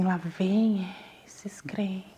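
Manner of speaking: slow scornful whisper; whispered aside. Retold or imitated. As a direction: mutter it under breath, mocking the believers. Cynical aside about church people.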